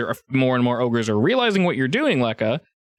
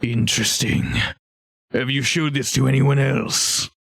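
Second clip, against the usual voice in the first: probing ogre; 2 semitones higher than usual. Acted.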